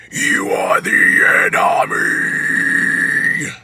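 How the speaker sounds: loud, very slow growled scream; low scream. Shown as a demonstration. Coach attempting the phrase in low register, low scream. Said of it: "See, it's not that low".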